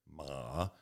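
"ma" sunk low and soft; imitating a teacher's low, dipping "ma"; dipping third tone. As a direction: dip "ma" down as if lowering the chin. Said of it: "Third tone, the dipping tone".

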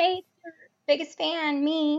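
playful fake fan message; mock fangirl hello. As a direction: gush it like a starstruck fan. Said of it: "So I was like".

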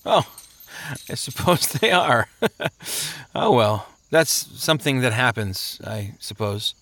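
sleepy chuckling shrug of a voice; lazy, unbothered sluggard. Acted. Sleepy man shrugs off his shackles, lazily amused. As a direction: trail off drowsily, amused and indifferent.

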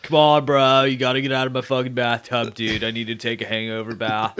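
loud frat bro voice. Acted. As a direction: bark it like a hungover frat bro. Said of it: "that's what they sound like".

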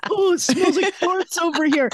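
high mock-disgusted kid voice; disgusted classmates. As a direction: imitate kids complaining about a stink. Classmates complaining, retold by daughter.

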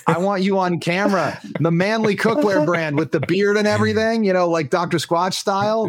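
high, eager sales pitch to a friend; playful, high-pitched enthusiasm; excited pitch. Real, not acted. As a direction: talk him into it, voice lifted high. Playfully pitching Mills as brand face.

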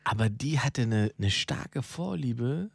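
ordinary talk

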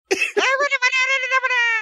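high screeching wail; mock rock screech. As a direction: screech like a metal singer. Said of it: "screaming or screeching".

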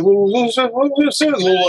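high, drawn-out little voice; tiny talking voice. Retold or imitated. Comedian recalling another comic's bit, tiny high voice. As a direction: imitate a comedian's tiny aside voice. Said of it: "tiny talking voice he did".

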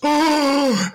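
a stunned yelp of disbelief; shocked oh. Retold or imitated. Imitating a listener's shock at the forecast, high stunned "oh". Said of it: "Bill Fleckenstein goes".

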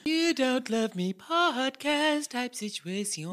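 high, loud, drawn-out announcer call; sing-song jingle-like flourish; hammed-up show title.

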